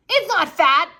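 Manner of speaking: high, loud indignant yell; shout. A narrator's reading. Peter shouting at Sheila about Izzy. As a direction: snap back in defence. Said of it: "I shouted".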